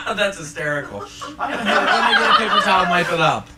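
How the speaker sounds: loud, high, laughing brush-off; amused laughter. Pretend. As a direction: laugh off the spill loudly.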